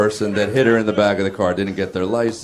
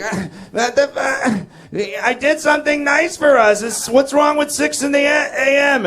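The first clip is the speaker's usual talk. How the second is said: much higher, louder mocking imitation of the wife; mimicking his wife; 3 dB louder than usual. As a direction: squeak it out like his wife defending herself. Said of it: "And she's like".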